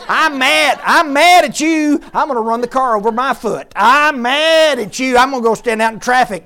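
loud, high hammed-up anger; mock angry rant. Preacher mocking someone who hurts themselves when mad, hammed-up loud anger. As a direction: ham up a petulant tantrum.

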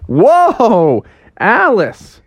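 high, awed exclamation; amazed Froggy. Acted. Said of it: "Froggy was like".

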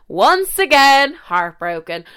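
once again slow, loud and high, then heartbroken drops; dramatic self-pity. Pretend. Storyteller mocking her own heartbreak, melodramatic. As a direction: ham up the heartbreak.

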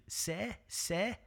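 put-on a soft, lightly done Liverpool kid calling sir; a hint of a small Scouse schoolboy; child Scouse accent. A small Scouse boy calls his teacher, light Scouse. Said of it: "I'm doing my best at a child Scouse accent".

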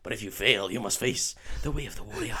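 hammed-up Scottish accent; solemn put-on Scots riddle; Oliver Wood. Keeper advising Harry on catching the ball, Scottish accent. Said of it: "for me to do a Scottish accent".

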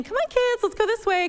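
put-on high, hurried calls to kids; alarmed parents. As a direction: gather the children quickly. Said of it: "Parents are like".

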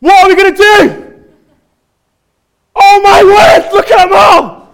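hammed-up panicked Mancunian yell, very high; acted panic. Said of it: "that's the panic".

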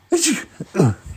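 theatrical coughing fit; hammed-up cough. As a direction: cough it out dramatically. Allergic to flowers.

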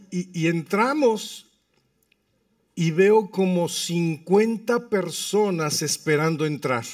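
ordinary talk, nothing marked

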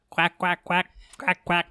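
quacking like a duck; playful duck quacks. Pretend. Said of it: "Look, now it's a duck".

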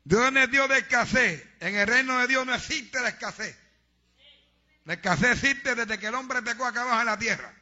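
fiery preacher denouncing tithe robbers; preaching at full force. Acted. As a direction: hammer every word home.

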